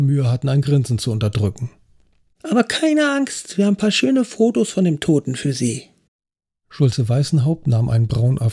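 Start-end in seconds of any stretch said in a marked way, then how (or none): 2.4-5.9 higher, perky voice of the pierced trainee; cheeky young officer. A narrator's reading. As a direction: play the cocky trainee introducing herself. Trainee offers photos.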